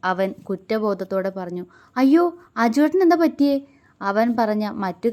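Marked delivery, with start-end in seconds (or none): 2.0-3.6 higher, louder alarmed question; worried young wife, alarmed; alarmed cry. Acted. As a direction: gasp it out in sudden worry. Sudden worry for injured brother.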